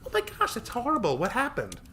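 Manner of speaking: put-on very high, dismayed girl voice; horrified for them; shocked and sympathetic. Tori horrified.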